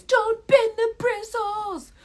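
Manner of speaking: high, slow, drawn-out haunting call; nagging inner voice. Acted. Her voice echoing in the viewer's head, nagging. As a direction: sing it out high, stretching every word. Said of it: "you'll hear my voice".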